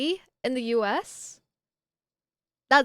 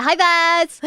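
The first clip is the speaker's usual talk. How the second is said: loud higher greeting; calling out; 6 semitones higher, 10 dB louder than usual. Real, not acted. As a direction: call across to him.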